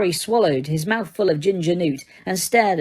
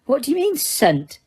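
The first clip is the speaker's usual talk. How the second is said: surprised stern; 8 semitones higher, slower than usual. A narrator's reading. Teacher puzzled and sharp.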